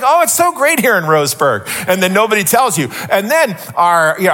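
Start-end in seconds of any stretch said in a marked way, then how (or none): 0.0-1.7 higher, sarcastic sing-song praise; mock cheerful local. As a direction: mimic the seller's breezy boast. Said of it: "he's just like".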